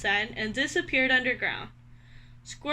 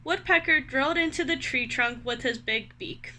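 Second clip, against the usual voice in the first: ordinary delivery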